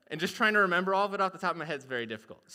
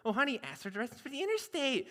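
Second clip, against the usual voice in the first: fast, lightly Midwest nagging at honey; Midwest wife; 6 semitones higher, 3 dB quieter, faster than usual. Acted. Wife urging husband to ask directions, Midwest. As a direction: nudge your husband, Minnesota style. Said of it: "has the most Midwest accent".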